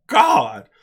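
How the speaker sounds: drawn-out stunned reaction in the theater; stunned oh my god. Retold or imitated. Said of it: "I'm like".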